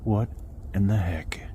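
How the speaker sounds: hushed startled whisper. Real, not acted. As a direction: whisper it, spooked by a noise. Startled by a noise at night.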